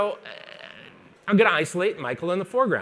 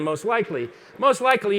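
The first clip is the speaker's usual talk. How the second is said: ordinary talk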